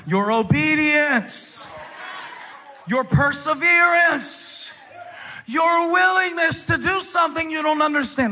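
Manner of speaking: preaching at full force. Acted. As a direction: drive the congregation to their feet. Preaching emphasis.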